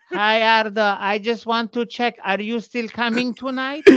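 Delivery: high, slow, deliberate mother voice; imitating mom. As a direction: mimic mom checking in by phone. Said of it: "She's like".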